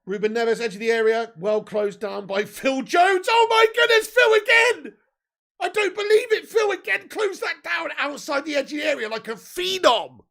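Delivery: loud call leaping into a high yell; excited commentary shout. Real, not acted. Fan calls Phil Jones closing down Neves, amazed excited shout. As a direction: erupt in amazed shouting at the block. Live call of another block.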